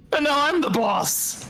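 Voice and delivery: high, slow gloating lord voice; dark lord. Acted. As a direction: proclaim it like a tyrant. Character lords it as boss.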